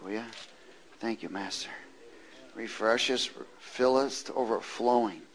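usual speaking voice

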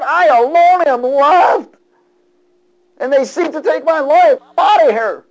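high, loud, anguished prophet's lament; Elijah crying out. Acted. Preacher voicing Elijah fleeing for his life, anguished and loud. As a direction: preach Elijah's despair at full voice.

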